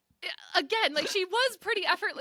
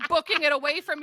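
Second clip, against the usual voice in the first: ordinary delivery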